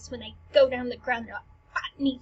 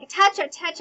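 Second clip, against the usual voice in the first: a bright raised call across the floor; called out touch; 4 semitones higher, 7 dB louder than usual. Acted. Fencer calling the hit to the judges, raised voice.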